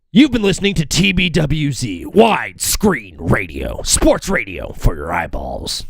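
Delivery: deep booming tagline; announcer voice. Shown as a demonstration. Host asking chat which tagline, in announcer voice. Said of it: "Are we gonna go".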